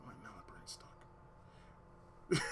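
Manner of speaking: drawn-out, voiceless murmur; slow whisper. Retold or imitated. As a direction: whisper it, dragging the words out. Retold answer to Damon.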